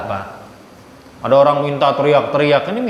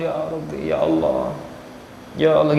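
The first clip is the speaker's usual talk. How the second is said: pleading whimper; 6 dB quieter than usual. Shown as a demonstration. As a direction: plead like someone desperate for help. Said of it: "merengek-rengek di hadapan Allah". (merengek memohon, suara jauh lebih pelan)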